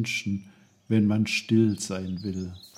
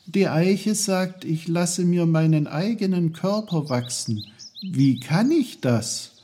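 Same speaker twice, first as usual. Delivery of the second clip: put-on lightly raised, curious boy's voice; a hint of a higher, friendly boy; young Peter, eager; 9 semitones higher, 4 dB louder than usual. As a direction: ask it politely like a boy talking to trees.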